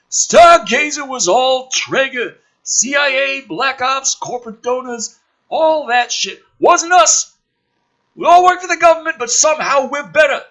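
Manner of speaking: high, loud brash military man; gruff officer voice. Acted. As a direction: play the cocky colonel loud and high. Woodhurst voiced in script reading.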